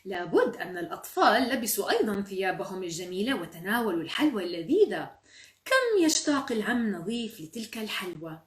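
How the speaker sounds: expressive, emphatic reading; children's story narration. A narrator's reading. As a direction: read to children, leaning on key words. Reads a children's story aloud.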